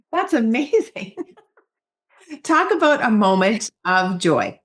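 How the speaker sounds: laughing through the words, slow and happy; laughing delight. Real, not acted. Host laughing with delight at the guest's bestseller news. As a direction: laugh it out with joy at her success.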